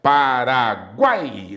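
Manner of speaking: Paraguai stretched slowly, syllable by syllable; drawn-out example word. Shown as a demonstration. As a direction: draw out the glide in Paraguai. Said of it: "olha só".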